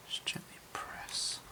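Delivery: soft slow whisper. Real, not acted. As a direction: hush it as you press. Gently pressing glue down.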